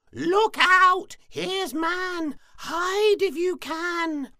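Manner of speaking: very high, loud bird screech; squawking jay. A narrator's reading. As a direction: shriek the warning like a jay. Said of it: "he heard Jay squawk".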